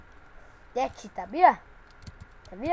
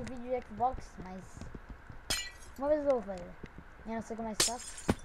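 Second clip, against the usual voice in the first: normal talking voice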